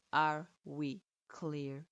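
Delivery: drawn-out, menacing question; menacing sensei. Acted. Sensei threatens her.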